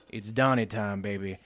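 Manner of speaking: Trump impression. Host mocking Trump ditching the script, lightly voiced low swagger. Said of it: "and he goes".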